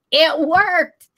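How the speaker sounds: high, giddy exclamation after laughing; amazed realization. Retold or imitated. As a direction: replay your astonished punchline. Acted realisation amid laughter.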